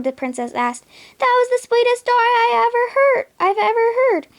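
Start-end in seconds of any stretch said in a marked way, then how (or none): 1.2-4.3 high, louder blubbering through tears; sobbing. Acted. Genie sobbing to Jasmine after her love story. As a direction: gush it out between sobs, overwhelmed. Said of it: "the genie said between sobs".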